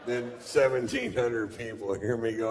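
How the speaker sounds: normal talking voice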